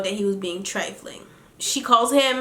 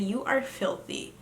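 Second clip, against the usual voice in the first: mock disgust; 10 dB quieter than usual. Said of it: "I said".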